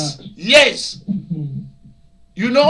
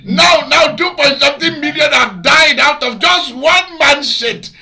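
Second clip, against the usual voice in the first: laughing shout; 6 semitones higher, 5 dB louder than usual. Real, not acted. Laughing rant about the pandemic.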